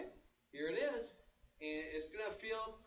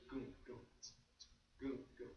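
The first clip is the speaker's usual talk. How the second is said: low "goom" for each bass drum hit; imitated bass drum; 6 semitones lower, 10 dB quieter than usual. Shown as a demonstration. Drum teacher showing the bass drum rhythm, low imitated kick. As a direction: sing the kick pattern as "goom". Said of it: "we have bass drums on one and the and of one".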